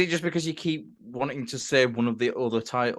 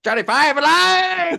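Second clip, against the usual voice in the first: high, loud, stretched-out movie quote; shouted catchphrase; 13 semitones higher, 6 dB louder, slower than usual. Acted. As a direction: shout it like a robot's triumphant catchphrase. Shouting a robot character's catchphrase.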